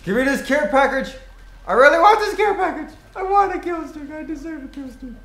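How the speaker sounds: high, loud demanding whine; whiny yelling. Real, not acted. Gamer loudly begging the game for a care package.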